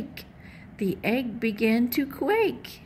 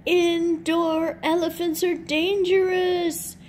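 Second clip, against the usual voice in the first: higher, louder squawky warning; high squawking chick voice; squawking smallest chicken; 7 semitones higher, 4 dB louder than usual. A narrator's reading. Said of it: "squawked the smallest chicken".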